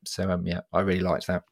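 ordinary talk

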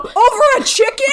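high, incredulous question; mock disbelief at the choice; incredulous guy. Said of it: "He's like".

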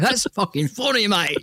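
high-pitched, exaggerated English accent; hammed-up English accent; mock English accent. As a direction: put on a cheeky English bloke voice. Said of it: "Sorry for the English audience".

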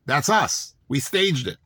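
ordinary delivery

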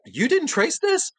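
squeaky high voice; high-pitched disbelief. Retold or imitated. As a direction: shoot way up in disbelief.

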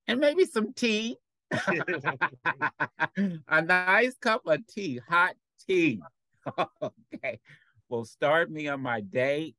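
playful high voice over a nice hot tea. Real, not acted. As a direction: voice jumps way up, laughing as she dreams of tea. Savoring the thought of tea.

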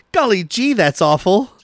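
put-on gee-whiz kid voice, high on golly; wholesome 1950s kid. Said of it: "he takes this shot of it and he goes".

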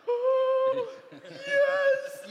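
high, loud, overjoyed yes; thrilled yes. Retold or imitated. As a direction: burst out like your dream came true. Thrilled at the job offer.

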